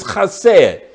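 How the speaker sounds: chesed said with a throaty ch, like the Scottish loch, stress on the first syllable: KHAH-sed; Hebrew word chesed. Shown as a demonstration. Pastor spelling out chesed for the congregation, rasped kh. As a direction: say chesed the Hebrew way, rasping the opening kh. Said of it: "C H E S E D".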